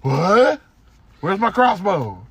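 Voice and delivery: slow, lightly voiced dazed actor; Norman Reedus confused. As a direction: play a baffled tough guy looking for his crossbow. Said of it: "all Norman Reedus does is just".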